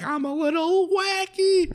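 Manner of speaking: very high, slow squeaky cartoon voice; imitated cartoon villain. Mimicking the steamrollered villain, high drawn-out cartoon squeal. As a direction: squeal it slowly like an inflating cartoon.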